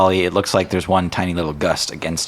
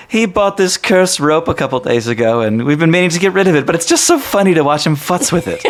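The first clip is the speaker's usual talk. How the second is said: high amused Megan; 10 semitones higher, 5 dB louder than usual. Acted. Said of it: "Megan says".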